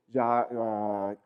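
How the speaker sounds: normal talking voice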